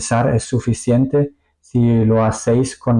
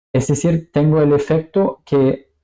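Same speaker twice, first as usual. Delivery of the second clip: ordinary delivery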